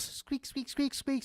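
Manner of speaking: high squeaks like a rat on a wheel; mock rodent squeaking; squeaky rat noise. Rats on squeaky wheels imitated.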